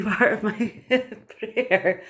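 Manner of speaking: talking through a laugh. Real, not acted. Laughs while excusing her singing.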